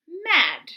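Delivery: MAD with the short A, as in "cat"; short A sound. Shown as a demonstration. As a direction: say MAD high and loud with a short vowel. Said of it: "A is making its short sound".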